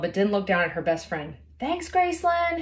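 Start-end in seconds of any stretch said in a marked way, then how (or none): 1.6-2.6 high, slow call upward; a high call from the rock face. A narrator's reading. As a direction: call down to a friend below. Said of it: "Haley called out".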